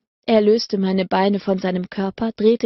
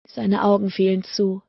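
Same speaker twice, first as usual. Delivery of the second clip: usual speaking voice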